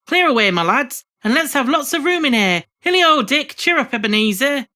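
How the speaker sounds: loud, cheerful orders; rally the lads loudly; hearty call. Acted. Fezziwig calling orders.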